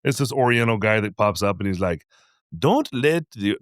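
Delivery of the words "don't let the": higher, drawn-out mock Indian accent. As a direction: do a bad Indian accent, slowly. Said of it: "he was Indian".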